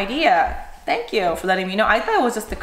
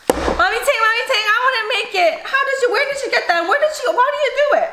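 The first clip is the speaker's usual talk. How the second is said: put-on much higher, fast and giggly, like an excited kid begging; eager fan voice; 12 semitones higher, faster than usual. A fan begging Mommy Tang for the recipe, high giggly and fast. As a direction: squeal it out as a thrilled viewer who wants to try.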